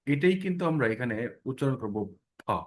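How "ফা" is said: ফা said as pha with a forceful puff of breath; ফা with a hard burst of air from the lips; aspirated pha. Shown as a demonstration. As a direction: blow a strong breath on ফা. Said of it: "এটার যে সঠিক উচ্চারণটা".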